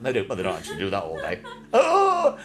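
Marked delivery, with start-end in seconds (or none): 1.7-2.4 high, loud put-on groan; a big high comic groan; hammed-up high wail; mock moan. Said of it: "no, that's Jimmy Savile".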